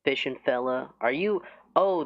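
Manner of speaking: normal talking voice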